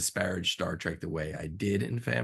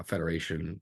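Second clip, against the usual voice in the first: ordinary delivery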